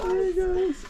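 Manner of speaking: high squeaky hello; squeaky greeting. Real, not acted. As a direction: squeak it to the pigs. Greeting the animals.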